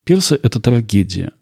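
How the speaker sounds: usual speaking voice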